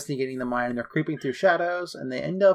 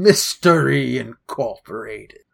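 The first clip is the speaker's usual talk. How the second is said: loud, drawn-out mock announcer boom; mock-epic booming title call; 7 dB louder, slower than usual. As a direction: boom it out slowly and grandly. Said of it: "I imagine it in that booming voice".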